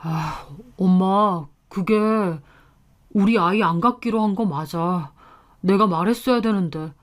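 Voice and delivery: stall, then admit it sheepishly to mother; reluctant, subdued confession; hesitant, faltering husband. A narrator's reading. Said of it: "남편은 우물쭈물 말하더라고요".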